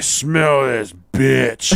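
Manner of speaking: put-on very slow drunken slur; drunk brother. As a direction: draw each word out like a drunk taunting. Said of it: "he was drunk".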